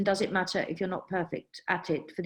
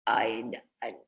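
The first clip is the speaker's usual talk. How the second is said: whispered hesitation; 10 semitones lower, 5 dB quieter than usual. Real, not acted. Stumped, breathes out words.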